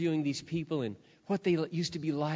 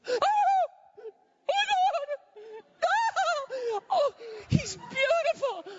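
much higher crying squeals of joy; ecstatic shrieking crowd; much slower than usual. Retold or imitated. Family and friends greeting him, shrieking with joy. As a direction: squeal like overjoyed relatives. Family seeing the made-over guy.

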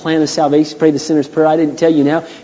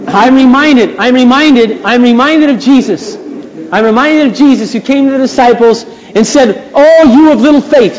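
raised, high-pitched sermon voice; full-force preaching; 7 semitones higher, 6 dB louder than usual. Real, not acted. Preacher recalling Jesus coming to sleepy disciples, full-force preaching. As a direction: build emphatically to the quoted rebuke.

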